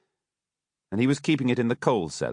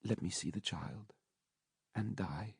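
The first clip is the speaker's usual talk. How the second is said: very soft, faint dying whisper; a dying mother's faint plea; 2 semitones higher, 14 dB quieter than usual. A narrator's reading. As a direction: barely get the words out. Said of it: "a faint voice articulated the words".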